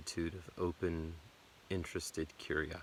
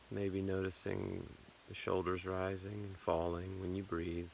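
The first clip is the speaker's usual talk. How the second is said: guided meditation; 6 dB quieter than usual. Acted. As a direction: ease them gently through the body, unhurried. Leads a body scan meditation.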